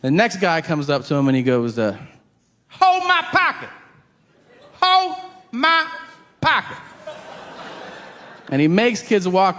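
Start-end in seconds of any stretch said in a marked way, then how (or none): 2.7-6.8 high-pitched, hammed-up convict command; put-on convict voice, pitched way up; intimidating inmate. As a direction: order them around as a crazed prisoner. Prisoner orders kids around.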